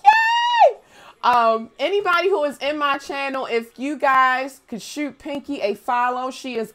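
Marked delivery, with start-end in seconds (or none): 0.0-0.8 high, loud cheer; excited cheer. Real, not acted. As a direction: cheer with big joy. Cheering for favorites.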